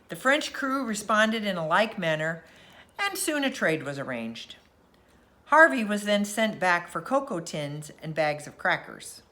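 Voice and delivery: usual speaking voice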